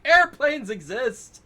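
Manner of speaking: high, loud, slow yell; exasperated shout. Retold or imitated. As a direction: yell it in disbelief. Yelling back at slogan chanters.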